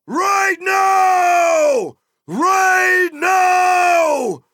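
high, full-force rallying shout with long drawn-out words; loud battle cry. A narrator's reading. King rousing his riders to war, loud battle cry. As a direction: roar the riders into the charge. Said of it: "he cried in a loud voice".

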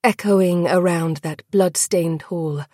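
ordinary talk, nothing marked

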